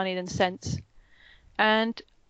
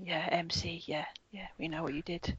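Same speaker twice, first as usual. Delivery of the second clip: whispered aside to the heroine; a sly whispered jab; conspiratorial whisper; 3 semitones lower, 10 dB quieter than usual. Acted. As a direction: whisper it like a knowing secret. Aside to the main character.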